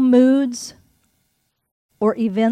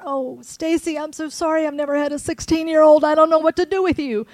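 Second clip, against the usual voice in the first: imitating crying apology; 6 semitones higher, 4 dB louder than usual. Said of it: "just crying and going".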